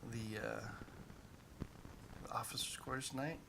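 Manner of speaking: hushed, hesitant conspirator; secretive undertone with long halting pauses; lightly whispered scheming. Acted. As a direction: keep your voice down, plotting mischief. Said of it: "Why are you whispering?".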